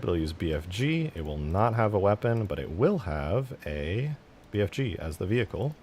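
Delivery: usual speaking voice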